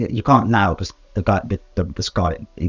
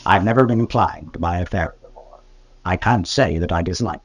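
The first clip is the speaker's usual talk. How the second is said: ordinary talk, nothing marked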